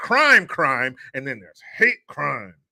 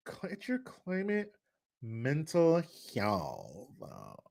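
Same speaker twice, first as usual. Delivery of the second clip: mocking sneer; 10 dB quieter, much slower than usual. Mocking the phrase's speakers.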